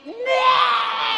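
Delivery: sudden high shout. Acted. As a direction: blurt it out loud and high. Mock shout reacting. (teriakan tinggi mendadak)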